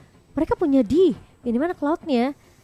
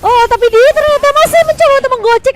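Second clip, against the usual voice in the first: excited; 9 semitones higher, 8 dB louder than usual. Real, not acted. Caster reacting to a play.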